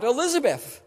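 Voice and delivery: joyful cry of a name; calling out. Retold or imitated. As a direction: call out her name in greeting. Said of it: "she cries out".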